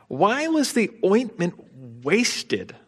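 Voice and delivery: indignant. Retold or imitated. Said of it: "said to themselves indignantly".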